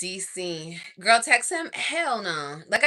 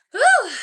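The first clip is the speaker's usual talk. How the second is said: whistle; 12 dB louder than usual. Real, not acted. Swooning over him.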